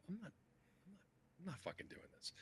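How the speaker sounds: whispered inner thought, unvoiced; under his breath, I'm not doing this. Recalling the recruiter's offer, whispering to himself in disgust. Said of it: "I'm thinking".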